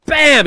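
loud, high "bam!"; impact exclamation. Retold or imitated. Host recreates Oiwa's forearm strike, loud impact shout. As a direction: punch it out like a sudden blow.

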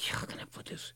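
hushed, searching for words; whispered aside. Real, not acted. Pausing to choose words about his old boss, a real whisper. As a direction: whisper it to yourself.

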